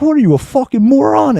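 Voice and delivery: high-pitched exasperated mock yell; retold yelling. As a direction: re-enact yelling an insult, voice way up. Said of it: "I start yelling at this guy".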